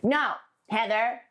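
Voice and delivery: teasing big brother refusal; brothers' dismissive no. Retold or imitated. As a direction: brush off the little sister, sing-song and firm. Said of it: "they'd be like".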